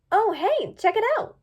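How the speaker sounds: high eager call; bright excited pony. A narrator's reading. Misty excited.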